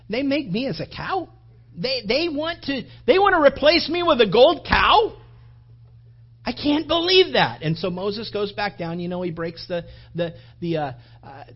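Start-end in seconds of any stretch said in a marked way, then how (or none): 0.1-7.6 God, wounded and outraged, rising higher and louder; indignant God. Acted. God, told of the golden cow, an indignant outcry. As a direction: build from hurt disbelief to an indignant outcry. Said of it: "and God says".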